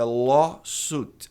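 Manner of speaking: LAWSUIT as "LAW-soot", slowly; correct pronunciation of lawsuit. Shown as a demonstration. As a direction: say "lawsuit" with plain U, no i. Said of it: "Esse U I tem som de U".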